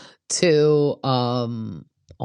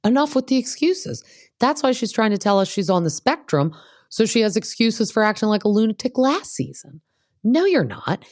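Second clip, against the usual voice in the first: pitched way up, fed-up scolding; exasperated rant; 9 semitones higher than usual. Real, not acted. Recapper scolding Tamara over the spectrum excuse, high and exasperated. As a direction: voice climbing in disbelief at her excuses.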